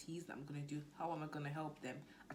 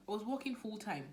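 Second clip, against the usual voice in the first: ordinary delivery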